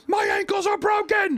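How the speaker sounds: high, strained shouted wail; mock pained yell. Injured skier yelling down the slope, high mock wail. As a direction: yell in pain about broken ankles. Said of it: "he's like".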